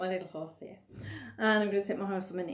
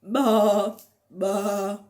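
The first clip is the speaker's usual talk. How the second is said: sheep noise; 9 dB louder than usual. Pretend. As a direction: bleat like a sheep. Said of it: "making the animal noises".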